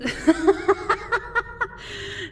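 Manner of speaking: loud high gloating laugh; high mocking cackle. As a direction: laugh in her face. Vampire mocks failed assassin.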